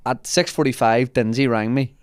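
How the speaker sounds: usual speaking voice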